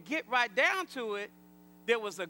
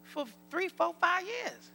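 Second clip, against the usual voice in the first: higher-pitched, taken-aback reply; retold surprise; 6 semitones higher, 5 dB quieter than usual. Preacher answers the husband about the name, surprised. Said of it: "I said".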